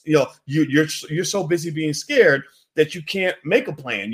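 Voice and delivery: ordinary delivery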